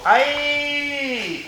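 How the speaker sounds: long, high held mock yell; imitated Brazilian yell. Mimicking how Brazilians yell in training, drawn-out mock yell. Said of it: "when they yell, they go".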